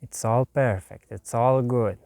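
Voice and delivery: normal talking voice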